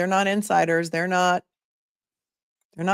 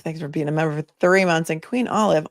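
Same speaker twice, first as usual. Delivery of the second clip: normal talking voice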